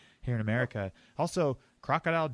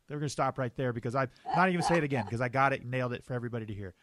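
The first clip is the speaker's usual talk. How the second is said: ordinary delivery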